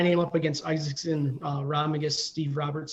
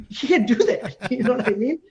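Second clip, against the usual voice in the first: high, loud, laughing protest; laughing disbelief; 7 semitones higher, 5 dB louder than usual. Retold or imitated. Father laughing at son who messaged the host.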